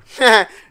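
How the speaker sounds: a loud burst of laughter at his own slip; sudden laugh. Real, not acted.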